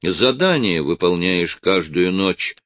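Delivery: plain reading voice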